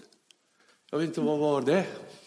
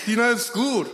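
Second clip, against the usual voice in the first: higher, louder call after someone; 8 semitones higher, 5 dB louder than usual. Retold or imitated. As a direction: call out to him as he leaves. Someone calls after him. (ropar efter honom, högre)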